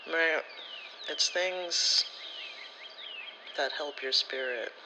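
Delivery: ordinary delivery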